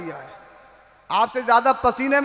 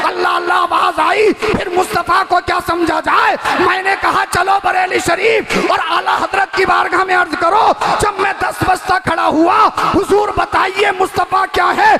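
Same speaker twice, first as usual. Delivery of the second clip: preaching at full force; 7 semitones higher, 7 dB louder than usual. Acted. Preacher reciting Ala Hazrat's verse to the crowd, full-force chanting. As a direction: cry the verse out to the hall.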